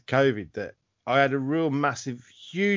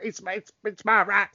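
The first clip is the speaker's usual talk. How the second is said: entitled Karen voice; 6 semitones higher, slower than usual. Retold or imitated. Imitating entitled anti-mask shopper.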